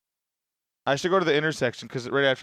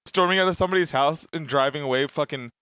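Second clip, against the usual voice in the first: usual speaking voice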